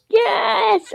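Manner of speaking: high, drawn-out cheer; gleeful cheer. Real, not acted. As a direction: cheer with delight at the video. Cheering at a funny video.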